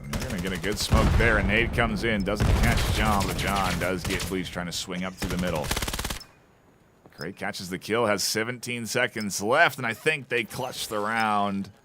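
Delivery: fast, rising play-by-play call; esports caster. Real, not acted. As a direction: call the action as it happens. Live play-by-play of a match.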